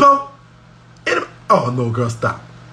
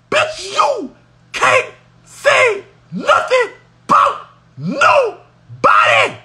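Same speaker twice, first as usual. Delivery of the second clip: slow drawn-out scold; 8 semitones higher, much slower than usual. Real, not acted.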